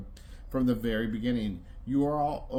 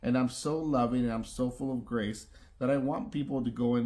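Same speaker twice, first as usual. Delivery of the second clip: ordinary talk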